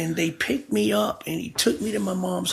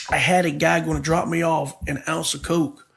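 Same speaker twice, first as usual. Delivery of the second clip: ordinary talk, nothing marked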